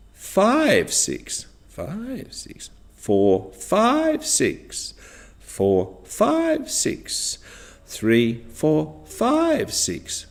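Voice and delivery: back-chain drill with "five" sung out high above the other numbers; rising on five. Shown as a demonstration. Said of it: "intonation was rising on the number five".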